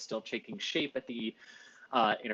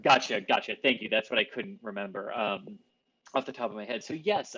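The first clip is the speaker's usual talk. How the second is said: ordinary talk, nothing marked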